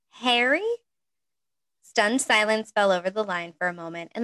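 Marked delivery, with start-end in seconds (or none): 0.1-0.7 surprised. A narrator's reading. Hermione surprised to hear Harry.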